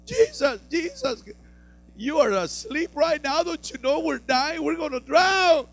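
high, frantic cries of panic; panicked disciples. Retold or imitated. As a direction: plead with Jesus in a high panic.

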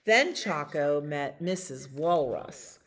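ordinary delivery, nothing marked

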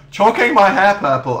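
loud, high announcer voice for a title; mock video title. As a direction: announce it like a clickbait headline.

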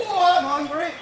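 normal talking voice

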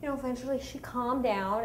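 usual speaking voice

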